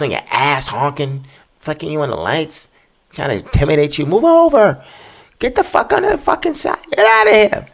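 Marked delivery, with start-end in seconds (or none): 4.0-7.7 much higher, louder mock road-rage yell; angry driver yelling. Mocking honking aggressive drivers.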